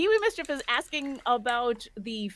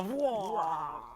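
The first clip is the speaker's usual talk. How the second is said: Cookie Monster voice; 4 dB quieter than usual. Retold or imitated. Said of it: "Cookie Monster's gonna go".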